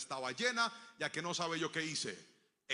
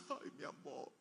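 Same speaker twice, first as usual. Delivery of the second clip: whispered, much softer tearful voice; mother weeping; 3 semitones lower, 12 dB quieter than usual. Retold or imitated. Mother to her son after the refusal, whispered and tearful. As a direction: breathe it through tears. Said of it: "se puso a llorar y dijo".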